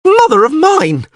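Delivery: loud, very high, stretched-out cry; panicked yell. A narrator's reading. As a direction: yell out in horrified recognition. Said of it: "yelled Dandelion".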